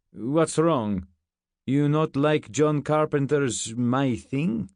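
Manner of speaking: put-on slow, puzzled Russian-accented question; thick Russian accent. A narrator's reading. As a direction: ask slowly in a heavy Russian accent. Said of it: "He had a thick Russian accent".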